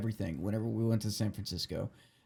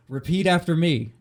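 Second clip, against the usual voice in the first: mock commanding order; 5 semitones higher, 6 dB louder than usual. Retold old man's commands.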